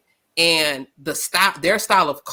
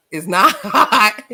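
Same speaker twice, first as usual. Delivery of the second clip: laughing; 2 semitones higher, 6 dB louder, slower than usual. Retold or imitated. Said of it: "be like".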